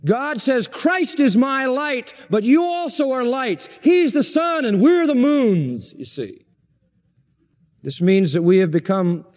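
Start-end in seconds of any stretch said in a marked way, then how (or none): 0.7-5.9 high and slow, building through the line; rising emphasis. Acted. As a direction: climb slowly toward the image of sun and moons. Preacher's rising quotation climax.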